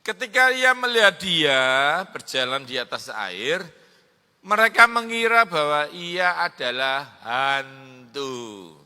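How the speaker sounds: loud, building preacher's voice; rousing sermon delivery; full-force preaching. Acted. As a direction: proclaim it to the hall.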